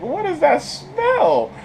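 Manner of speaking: high, slow incredulous voice; suspicious social worker. Retold or imitated. Social worker smells something.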